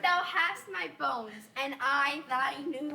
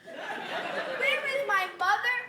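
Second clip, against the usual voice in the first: incredulous; 7 semitones higher, slower than usual. Acted. Juliet exasperated at nurse's reply.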